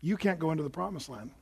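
soft, low pronouncement; hushed, understated rebuke from the Lord; grave verdict. Acted. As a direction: deliver the sentence quietly. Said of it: "the Lord said".

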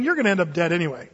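usual speaking voice

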